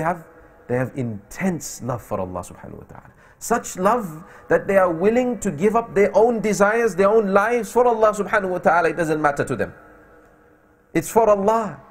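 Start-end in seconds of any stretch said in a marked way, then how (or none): 3.4-11.8 raised, insistent sermon delivery; fervent preaching. Real, not acted. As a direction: drive the message home with rising fervor. Preaches at full force.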